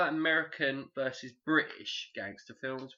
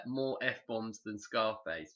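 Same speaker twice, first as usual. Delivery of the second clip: ordinary talk, nothing marked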